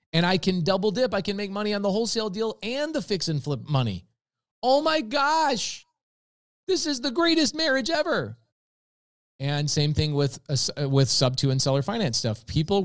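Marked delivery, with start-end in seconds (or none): 0.1-8.4 much higher, thrilled and gushing, slowing on oh my gosh; excited realization. Retold or imitated. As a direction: play the sudden joy of a brilliant idea. Excited realization, acted inner monologue.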